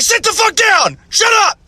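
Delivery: very high-pitched shouted orders; frantic mock yell at the kids; bank robbery yelling. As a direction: imitate parents screaming commands like robbers. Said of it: "it sounds like a bank robbery".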